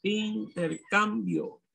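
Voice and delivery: syllable by syllable. Shown as a demonstration. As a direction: dictate Intercambio slowly, in-ter-cam-bio. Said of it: "Me viene en sílaba".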